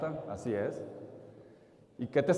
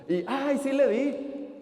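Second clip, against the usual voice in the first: excited; 7 semitones higher, much slower than usual. Acted. Boy cheering his lucky shot.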